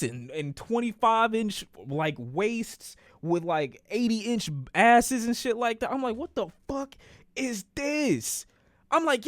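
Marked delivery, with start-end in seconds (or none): 6.2-8.5 high, drawn-out bewildered question; baffled disbelief. Retold or imitated. Storyteller acting out his shock at the screen, baffled. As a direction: stretch it out in mock bewilderment. Acts out stunned reaction to screen.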